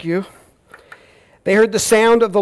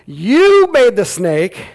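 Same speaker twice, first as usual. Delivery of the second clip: louder and higher, slow and pointed; accusing retort; 7 semitones higher, 8 dB louder, much slower than usual. Acted. Preacher imagining Eve blaming God outright, accusing. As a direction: fling the blame at God. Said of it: "but I'm not gonna be like".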